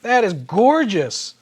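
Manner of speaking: loud, high, drawn-out wow; awestruck delight. Real, not acted. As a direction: savour each word in open admiration. Marvelling at the revealed figure.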